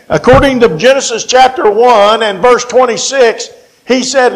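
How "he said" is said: ordinary talk, nothing marked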